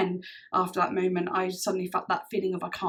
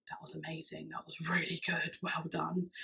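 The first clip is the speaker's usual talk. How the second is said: hushed praise; 3 semitones lower, 12 dB quieter than usual. Retold or imitated. Rosie praising her, softly voiced.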